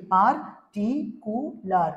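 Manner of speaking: "পার্টিকুলার" slowly as পার, টি, কু, লার; four separate beats on "পার্টিকুলার"; syllable by syllable. Shown as a demonstration. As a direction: pause between the syllables of "পার্টিকুলার". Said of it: "কটা syllable হলো".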